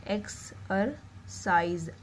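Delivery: एक्सरसाइज drawn out, the साइज clearly voiced; slow demo word. Shown as a demonstration. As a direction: stretch एक्सरसाइज to show the आई sound. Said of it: "आई की आ रही थी". (एक्सरसाइज धीरे-धीरे बोलना)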